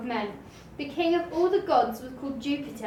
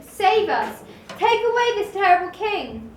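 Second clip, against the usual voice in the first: loud, higher, frantic begging; desperate plea; 6 semitones higher, 7 dB louder than usual. A narrator's reading. Bullfrog begging Jupiter to save the frogs, frantic and loud. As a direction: cry out for rescue.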